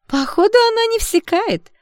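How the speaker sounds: put-on very high, loud mean-girl jeer; high-pitched mocking friend; jeering sidekick. A narrator's reading. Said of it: "подхватила другая подружка".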